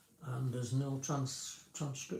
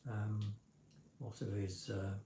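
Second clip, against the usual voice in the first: normal talking voice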